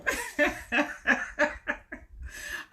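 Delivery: long airy laugh; breathy laugh. Real, not acted. Laughing at own coughing.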